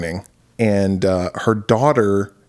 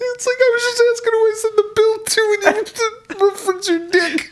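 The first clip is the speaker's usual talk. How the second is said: fast, high-pitched words through laughter; mock outrage while cracking up; real laughing fit; laughing complaint. Real, not acted. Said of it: "I can't stop laughing".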